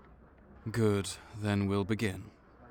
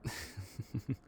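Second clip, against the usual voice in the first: a quick breathy chuckle under the breath; soft laugh; 11 dB quieter than usual. Real, not acted. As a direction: laugh softly to yourself. Counselor amused at patient's fangs.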